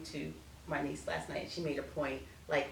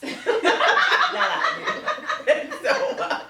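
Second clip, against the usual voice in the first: high, loud, slow laugh through the words; cracking up at herself; laughing fit while trying to go on; 13 dB louder, much slower than usual. Real, not acted.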